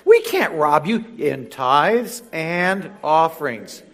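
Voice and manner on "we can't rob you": high, slow, mock-innocent protest; a hint of indignant churchgoer; protesting congregation. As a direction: play the offended doubter. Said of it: "you say".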